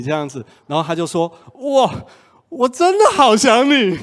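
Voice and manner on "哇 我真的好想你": much higher, louder, slow lovesick wail; wailing longing. Retold or imitated. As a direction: cry out how much you miss him. Girlfriend voiced, crying out longing. (高声拉长的哭诉想念)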